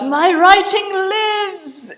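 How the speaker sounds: high, drawn-out triumphant yell; exultant cry, held high; triumphant shout. Retold or imitated. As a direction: shout it loud and long, like a proclamation. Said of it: "he shouts".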